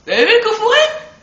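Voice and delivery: high, loud thrilled ask; excited question. Real, not acted. Gamer shows viewers the chest, high and thrilled. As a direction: share the find with delight.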